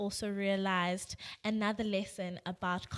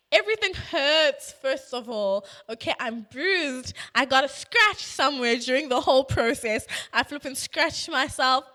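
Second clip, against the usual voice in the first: louder, hammed-up list of hurts; mock wailing complaint; 4 semitones higher, 6 dB louder than usual. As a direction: whine it out loud for comic effect. Acts out tearful complaints in toilet.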